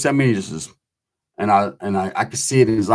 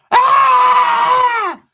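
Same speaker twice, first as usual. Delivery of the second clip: sudden yell; 5 dB louder than usual. Retold or imitated. Inmate being beaten in cell.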